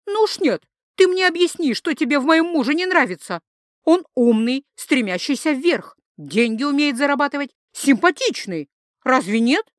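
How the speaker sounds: high-pitched, insistent wife's voice; indignant young wife. Acted. Wife demands rival explain her dislike, high and indignant. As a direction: dig in and argue, pitched high.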